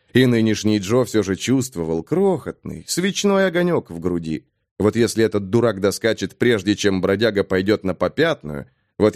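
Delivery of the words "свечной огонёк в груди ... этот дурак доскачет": ordinary delivery, nothing marked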